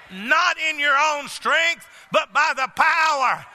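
fiery revival preaching; full-force preaching. Acted. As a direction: preach it out with power, building to a shout on God.

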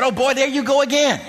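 high-pitched retold scolding; mad mama. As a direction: imitate an exasperated mother telling off her son. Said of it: "my mama got mad at me".

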